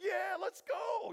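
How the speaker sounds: high, drawn-out hyped yell; jacked-up shout. Acted. Armor bearer answering Jonathan, jacked-up shout. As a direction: whoop it out, fully pumped up. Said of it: "gets them all jacked up".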